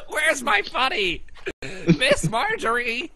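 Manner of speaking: slow, high wail through laughter; hammed-up lost child calling for help; mock freak-out. Teasing Evan as a kid looking for his buddy, mock panic. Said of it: "Evan was freaking out".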